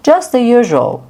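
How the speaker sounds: lively casual answer; demo reply. Shown as a demonstration. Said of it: "demo reply".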